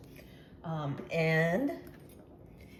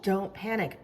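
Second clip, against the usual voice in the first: ordinary talk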